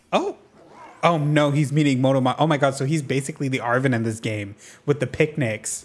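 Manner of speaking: usual speaking voice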